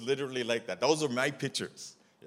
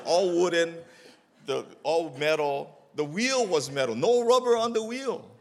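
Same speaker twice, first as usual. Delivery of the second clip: louder, high exasperated amusement; amazed; 7 semitones higher, 10 dB louder than usual. Retold or imitated. Describing the ancient wheelbarrow, loud amazed. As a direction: marvel at the old junk.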